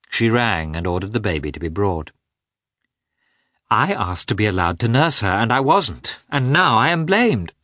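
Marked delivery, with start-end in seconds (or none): 3.7-7.5 building higher and louder to a cry; rising grievance. Acted. Anna protests to her husband, rising higher and louder. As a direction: climb from hurt to outrage.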